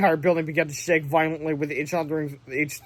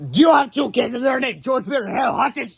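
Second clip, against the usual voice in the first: higher, loud demanding yell; villain shouting; 7 semitones higher, 5 dB louder than usual. Acted. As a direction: bellow it at the door. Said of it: "shouted Timmy".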